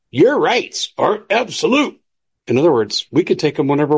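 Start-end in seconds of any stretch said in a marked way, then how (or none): 0.1-2.0 high, slow mock old politician voice; mocking Joe Biden. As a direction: ham up an old president's voice. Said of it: "He said".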